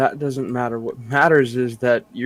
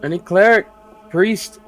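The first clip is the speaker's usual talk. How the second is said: high, loud searching call; 8 semitones higher, 10 dB louder than usual. Acted. Player calls downstairs for a cleric, calling out. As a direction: call out for anyone within earshot. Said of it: "I'd like to call out".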